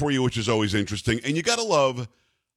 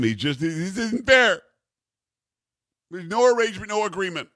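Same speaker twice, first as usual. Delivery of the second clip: mimicking whiny mayor; 6 semitones higher than usual. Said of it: "he actually says that, "I'm mad".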